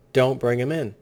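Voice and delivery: normal talking voice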